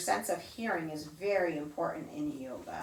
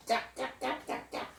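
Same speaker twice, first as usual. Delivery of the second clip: softer, quick rattling duck duck like a jackhammer; jackhammer imitation; 5 semitones higher, 5 dB quieter than usual. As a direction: rattle it like a jackhammer far away. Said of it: "as we walk by".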